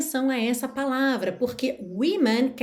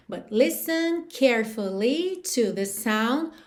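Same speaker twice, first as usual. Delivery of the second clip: slow demo; slower than usual. Shown as a demonstration. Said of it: "teacher reads song line slowly".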